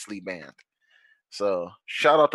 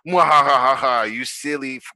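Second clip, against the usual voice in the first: mustache twirling villain; 3 semitones higher, 6 dB louder than usual. Retold or imitated. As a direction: gloat like a cartoon villain. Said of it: "They're probably like".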